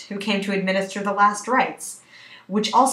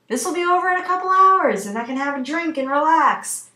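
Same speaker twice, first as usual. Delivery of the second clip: high, louder self-reassurance easing down slightly; self pep talk; 7 semitones higher, 5 dB louder than usual. Acted. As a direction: talk yourself up, pitched high. Actress giving herself a pep talk.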